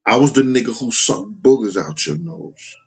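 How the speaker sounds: ordinary talk, nothing marked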